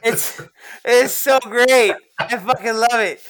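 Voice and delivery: loud, laughing gush; laughing delight. Real, not acted. Podcaster raving about a favourite movie scene, laughing delight. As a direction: stretch it out loud through laughter.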